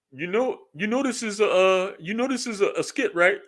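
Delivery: high-pitched mocking imitation of a know-it-all commenter. Regular people pointing out it's a skit, high mocking voice. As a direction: put on a high voice to mimic people. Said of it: "it's regular American people that's just like".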